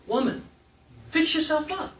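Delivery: a lazy husband ordering his wife around, mockingly; bossy husband. Teacher mocks a slob husband ordering his wife: high mock command.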